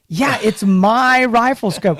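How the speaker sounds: retold incredulous reply. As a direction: stretch it out as if stating the obvious. Retold baffled answer to the company.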